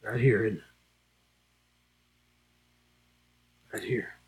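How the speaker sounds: a quiet, tender aside; hushed, intimate. Real, not acted. Preacher hushes to touch the congregation's wounded heart, softly. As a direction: drop to a near hush on the heart.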